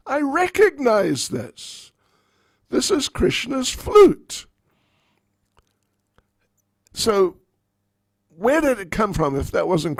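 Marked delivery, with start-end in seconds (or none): 0.1-4.5 high, loud, slow shout of recognition; shouted accusation. Acted. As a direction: bellow it like proof in hand. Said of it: "looked at the flute and said".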